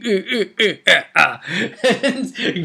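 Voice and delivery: gleefully wicked game-master cackle; evil chuckle. Acted. Game master gloating over a sinking player, evil laugh.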